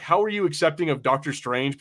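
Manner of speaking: ordinary talk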